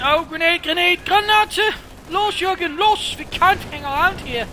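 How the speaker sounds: high, loud frantic soldier shout; grenade warning yell. Acted. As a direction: yell the warning to your squad. Caster voices soldier spotting grenade.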